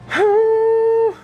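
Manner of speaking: a long, loud, high-pitched exasperated sigh; heavy sigh. Real, not acted. Vlogger fed up that the day starts late, loud high sigh. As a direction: let out a big fed-up groan of a sigh.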